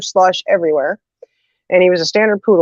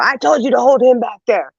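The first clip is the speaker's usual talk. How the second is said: higher, mock-angry telling off; mad dad; 6 semitones higher than usual. Dad opening car door on the kids, mad. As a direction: imitate an annoyed father scolding. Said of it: "he was so mad".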